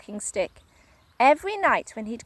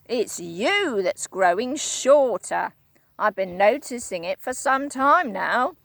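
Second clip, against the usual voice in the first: Mister Twit, grinning horribly; 4 dB louder than usual. Acted. Husband blaming his wife, wicked grin. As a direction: savour each word wickedly. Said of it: "said Mister Twit".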